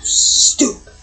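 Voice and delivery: soft breathy whisper; a fond whispered insult about the cat; whispered aside. Real, not acted. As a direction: whisper it like he might overhear.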